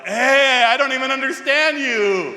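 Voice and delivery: high, loud stoned yell across the operating room. Retold or imitated. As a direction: shout it out, loopy on the drug. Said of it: "I yelled".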